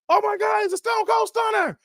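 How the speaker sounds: screaming high ringside call; wrestling commentator shout. Retold or imitated. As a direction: lose it like a commentator at the big move. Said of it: "He, no, Jerry King Lawler".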